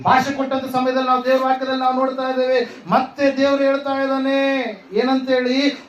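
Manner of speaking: high, driving sermon delivery; fervent preaching. Acted. Preacher telling how God sent Hagar on with her son, fervent preaching.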